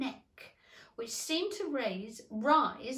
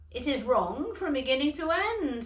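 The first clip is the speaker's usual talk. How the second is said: loud, flat verdict; lightly voiced stern caterpillar; 5 semitones higher, 9 dB louder than usual. A narrator's reading. Caterpillar scolding Alice, loud and firm. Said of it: "said the caterpillar".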